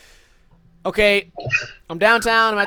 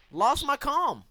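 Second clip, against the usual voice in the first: ordinary delivery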